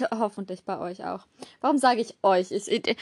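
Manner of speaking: ordinary talk, nothing marked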